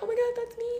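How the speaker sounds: high-pitched, hushed squeal; mock panicked realization. Hearing her name called at the airport, high panicked squeak. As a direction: squeak it in retold alarm.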